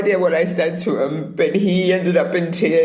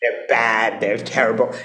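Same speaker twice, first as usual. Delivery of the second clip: mocking; 5 semitones higher than usual. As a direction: sneer at the old warnings. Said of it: "And I was like".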